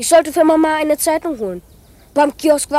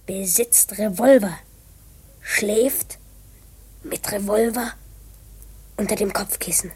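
hushed notes; 6 semitones lower, 5 dB quieter than usual. Acted. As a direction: breathe the notes like a secret. Writing secret note in notebook.

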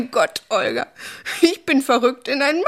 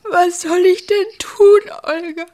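despairing plea; 7 semitones higher, 6 dB louder than usual. Acted. Gilgi pleading with Olga.

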